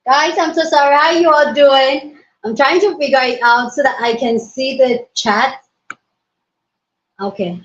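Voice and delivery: normal talking voice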